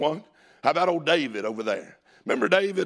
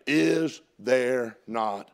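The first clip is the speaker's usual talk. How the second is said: low, drawn-out words fading away; slow, weighty question; 3 semitones lower, much slower than usual. Acted. Preacher quoting David's statement to the church, slow and hushed.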